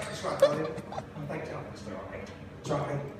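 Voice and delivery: low, soft murmured courtesies; polite door-holder. Acted. Man holding the door for a whole family, polite murmur. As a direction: murmur it low as people file past.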